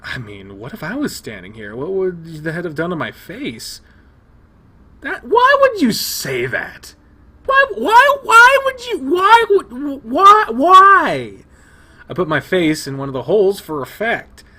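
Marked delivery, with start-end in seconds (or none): 5.0-11.4 squeaky, much higher, loud repeated why; shrill outrage. Real, not acted. Player reacting to Leo's dark joke, shrill loud outrage. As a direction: sputter in disbelief, voice cracking up high.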